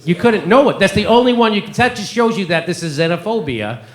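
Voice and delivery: ordinary delivery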